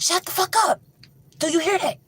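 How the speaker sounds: high, tense and scared; tense hushed warning. Retold or imitated. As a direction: silence them, listening for danger. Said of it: "Kathy like".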